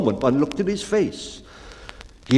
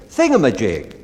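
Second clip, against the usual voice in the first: louder call; loud cry; 7 dB louder than usual. A narrator's reading. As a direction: call out Thingamajig. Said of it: "or to any loud cry".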